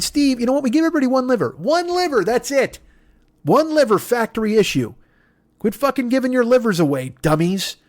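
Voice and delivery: high-pitched exasperated rant; ranting Jesus. Acted. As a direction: scold everyone like a fed-up Jesus. Jesus ranting about livers.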